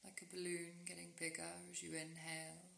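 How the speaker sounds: soft, unhurried meditation voice with long pauses; guided sleep meditation. Real, not acted. Guiding a listener into rest with yoga nidra, calm meditation voice.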